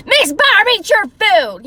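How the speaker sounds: high, loud mock yell at someone; mean yelling. Shown as a demonstration. Imitating caregiver yelling at a client, mean yell. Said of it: "she was yelling".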